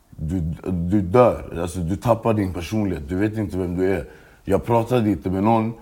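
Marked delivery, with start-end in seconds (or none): 0.1-1.6 slow emphasis. Real, not acted. Stresses slowly.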